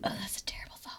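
whispered in disgust. Real, not acted. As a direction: breathe it out, grossed out. Disgusted reaction.

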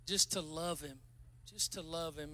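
soft, low repeated plea; softly repeating the call to love; tender. Real, not acted. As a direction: ease it down gently.

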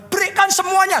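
high, urgent call to give; voiced high and forceful; emphatic challenge. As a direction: ring it out as a bold command.

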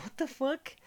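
acting out a baffled double take; retold bewilderment. Man hearing a voice from nowhere, bewildered. Startled reply to hidden heckler.

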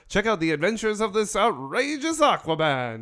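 big comic-book promo read; hyped announcer voice. Retold or imitated. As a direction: sell it like an old radio ad. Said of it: "They're like".

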